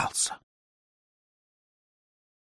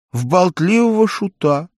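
ordinary delivery

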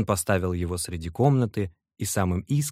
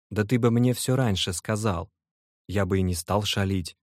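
usual speaking voice

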